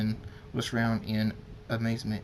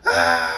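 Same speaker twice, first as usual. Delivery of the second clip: lower, very loud raging scream; furious roar; 5 semitones lower, 12 dB louder than usual. A narrator's reading. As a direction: roar out in fury. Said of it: "and he screams".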